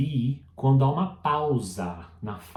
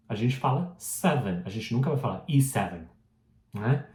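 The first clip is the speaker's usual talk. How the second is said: usual speaking voice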